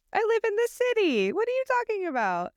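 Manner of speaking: high-pitched playful incredulity; mock indignation. Dallas resident answering horse question, mock indignant. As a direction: protest in a squeaky, put-upon voice. Acting indignant city-dwelling Texan.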